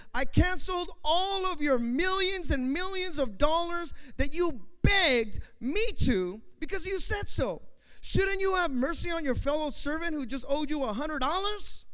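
high-pitched, indignant master's reproach; angry rebuke. Acted. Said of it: "In anger, his master handed him over".

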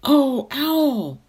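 put-on high, loud boyish exclamation; delighted cry. A narrator's reading. The Wart cries to the owl who spoke to him, excited. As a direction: cry out, forgetting every trouble at once. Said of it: "cried the wart".

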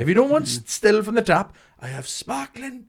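high mock host voice. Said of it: "He was like".

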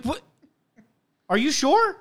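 high startled question; disbelieving. Retold or imitated. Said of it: "I was like".

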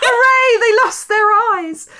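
high, loud mock cheer over the gruesome scene; mock glee. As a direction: cheer it like a gleeful kid, tongue in cheek. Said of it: "I was gleeful".

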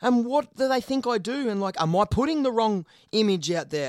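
a mock bewildered inner voice; baffled self-questioning. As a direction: ask yourself in disbelief what they think of you. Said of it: "You end up going away going".

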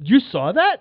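high, loud caught-out question; shocked she saw it; surprised shout. Retold or imitated. Embarrassed surprise at mom.

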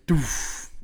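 short breathy "doof" thud; imitated thud. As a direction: puff out the sound of a dull thud.

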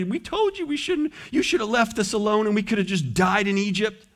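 high, whining complaint; whiny Israelites. Acted. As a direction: whine like a bitter crowd.

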